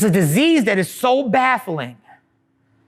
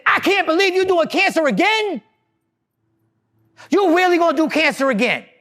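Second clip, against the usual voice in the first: much higher, shouted mock outrage; outraged scolding; 7 semitones higher than usual. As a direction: yell at a cancer patient for relapsing, hammed up. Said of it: "angry and upset and outraged".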